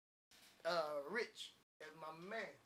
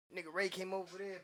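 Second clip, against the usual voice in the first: normal talking voice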